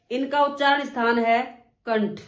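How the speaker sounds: normal talking voice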